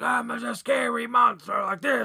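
shouted hammed-up monster voice; a put-on creepy creature, yelled; mock scary monster. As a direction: play a cheesy movie monster shouting. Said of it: "he was talking like this".

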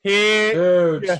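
ad read. Pretend. Mimicking the ad pitch.

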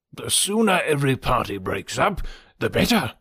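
lightly voiced fussy old gentleman; a hint of a contented, stubborn old man; placid old father. Voices Mister Woodhouse.

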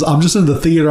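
usual speaking voice

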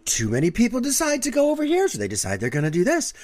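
high, loud mock-outraged pundit; Seth Meyers mocking Tucker Carlson. Recalling a late-night bit to partner, high mocking pundit impression. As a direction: escalate in a high, swooping parody of a TV commentator. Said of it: "Seth Meyers makes fun of Tucker Carlson".

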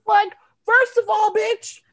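high, loud sassy retort; indignant. Real, not acted. Comic to friends about fan threat, high indignant sass. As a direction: snap back with mock outrage.

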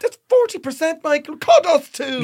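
high, loud exasperated mum voice; hammed-up parent scolding her son; imitating a frazzled mum. Mum from a viral clip nagging her son, high exasperated. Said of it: "It was beautiful".

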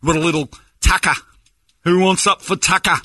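high, loud tourist Aussie; hammed-up fake Australian accent; mock Australian accent. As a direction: bellow like a clueless faux Australian. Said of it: "walking around your country going".

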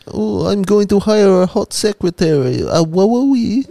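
high-pitched, hammed-up comic foreigner voice; Borat. Joker crowing about hiring a secretary, hammed-up Borat impression. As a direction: put on a silly high voice, leaping up at the end. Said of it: "Ah, that was Novak Borat".

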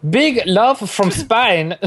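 slow, high fake Spanish accent. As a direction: attempt a Spanish accent, drawn out. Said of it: "How do you do a Spanish accent?".

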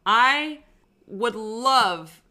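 loud, high, drawn-out launch into a rant; upset outburst. Real, not acted. Podcaster fuming at the wrestling show, upset and loud. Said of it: "I'm so upset right now".

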